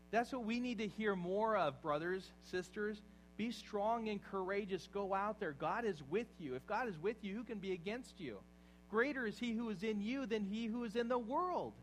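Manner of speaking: loud, rising exhortation to the brothers and sisters; rally the room to courage; fiery preaching. Acted. Rousing the congregation.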